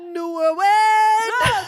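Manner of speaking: slow, drawn-out show intro; dramatic announcer. Pretend. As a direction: stretch it like a grand announcer.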